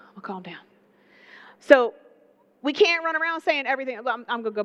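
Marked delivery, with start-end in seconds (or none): 0.1-0.6 whispering to settle himself; hushed whisper to himself; whispered calm down. Real, not acted. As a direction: breathe it out unvoiced.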